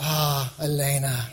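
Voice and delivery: low, tender sigh over someone beloved; adoring Jesus. Acted. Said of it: "Jesus looks at you and goes".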